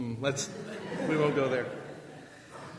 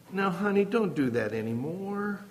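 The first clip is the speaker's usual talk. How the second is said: passive parent. Retold or imitated. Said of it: "Or passive".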